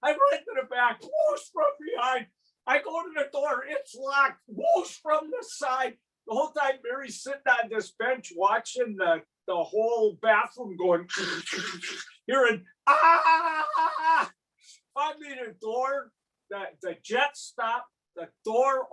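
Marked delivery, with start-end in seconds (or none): 1.0-2.3 imitated whoosh. Imitating toilet jets. 4.5-6.0 imitated whoosh. Imitating toilet jets. 12.9-14.3 high, loud retold yell; mock yell. Trapped in the self-cleaning toilet, mock yell. Himself yelling inside the toilet.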